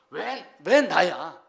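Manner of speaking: loud, drawn-out cry of protest, mimicked; retold cry to heaven. Preacher retells a man shouting at the sky, mock yell. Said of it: "소리 지르는 내용이 나와요".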